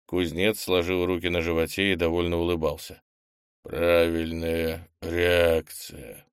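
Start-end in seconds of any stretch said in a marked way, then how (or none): 3.6-6.2 slow, strange distorted voice; distorted alien voice. Acted. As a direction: speak like something else inside the old man. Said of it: "совершенно чужим, искажённым голосом".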